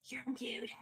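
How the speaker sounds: whispered aside, barely voiced. Real, not acted. Host whispering to co-host that her mic is muted. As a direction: breathe it so only she hears.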